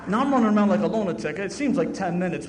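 loud, fast, laughing through it. Real, not acted. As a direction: laugh as you tell it. Laughing while telling story.

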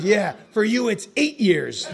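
high, loud retort, slowing at the end; mock emphatic put-down. Old-timer takes down the newcomer who is a doctor, mock emphatic. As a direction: land the punchline on the cocky newcomer.

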